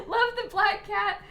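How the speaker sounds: ordinary talk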